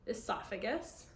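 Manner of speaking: plain reading voice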